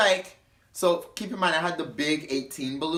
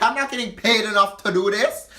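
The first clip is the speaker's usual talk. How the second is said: higher, louder whiny complaint; drunk whine; 7 semitones higher, 6 dB louder than usual. Retold or imitated. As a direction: whine it like she did. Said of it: "her voice sounded just like that".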